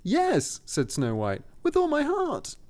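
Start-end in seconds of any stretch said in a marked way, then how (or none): 0.0-0.6 put-on very high girlish reply. A narrator's reading. 1.6-2.5 put-on very high girlish reply. A narrator's reading.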